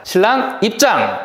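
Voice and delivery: slow drawn-out MC announcement; stage introduction. Shown as a demonstration. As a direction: call the groom in like an emcee. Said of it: "한번 예를 들어보겠습니다". (느리게 끄는 사회자 호명)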